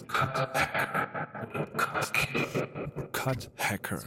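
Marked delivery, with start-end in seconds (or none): 0.1-1.7 cut hacker effect. Shown as a demonstration. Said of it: "So heißen diese Effekte". 1.8-2.7 cut killer effect. Shown as a demonstration. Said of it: "So heißen diese Effekte".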